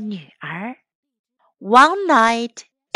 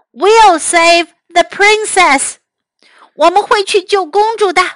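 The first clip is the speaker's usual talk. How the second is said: brave knights; 6 semitones higher, 5 dB louder than usual. Acted.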